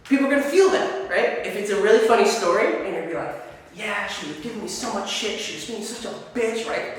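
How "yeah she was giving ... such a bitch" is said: mock arguing. Shown as a demonstration. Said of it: "this is me, like, showing that someone is arguing".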